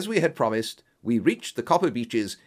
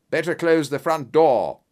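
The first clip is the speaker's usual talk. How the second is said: loud, raised command; higher, louder detective's shout; urgent cry; 5 semitones higher, 7 dB louder than usual. Acted. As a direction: call out an urgent order. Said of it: "cried Holmes".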